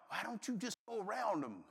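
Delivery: soft, laughing memory of a gentle nudge; remembered wife's voice. Retold or imitated. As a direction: recall her words quietly with a chuckle. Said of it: "I remembered my lovely wife's voice".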